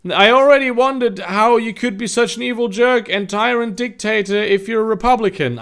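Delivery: high-pitched, animated read-aloud; story narration. Acted.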